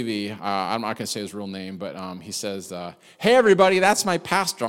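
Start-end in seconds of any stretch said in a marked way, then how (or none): 3.2-4.7 high and loud, announcing to everyone; calling out. Retold or imitated. Former unbeliever calls out to the funeral crowd, loud and high. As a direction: proudly announce to the room.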